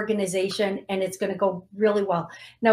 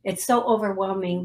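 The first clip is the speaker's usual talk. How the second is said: ordinary delivery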